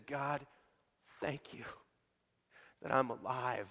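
put-on boy's hushed grateful prayer at the meal; whispered thanks. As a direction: breathe the thanks out quietly, almost to yourself.

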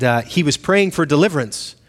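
ordinary delivery

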